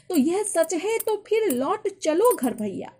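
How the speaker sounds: slow, high declaimed verse line; poem recitation. Acted. Teacher returning to the poem's line for students, declaimed.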